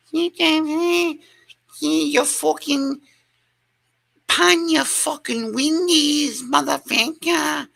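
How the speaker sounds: very high, slow mock rant with a shout at the end; Nicola's rant voice. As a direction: squeal out a garbled angry rant. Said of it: "she's going to say, she's gonna go on a rant".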